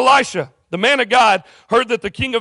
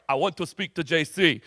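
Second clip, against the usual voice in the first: low, soft attempt at a Russian accent; bad Russian accent; 4 semitones lower, 5 dB quieter than usual. Putin asking to see the pastor, bad Russian accent. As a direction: lightly put on a horrible Russian accent. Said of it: "it's a horrible Russian accent".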